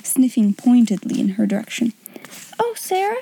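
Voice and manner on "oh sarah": put-on high, relieved girl's cry. A narrator's reading. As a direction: call out to her friend, much higher. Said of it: "she cried out".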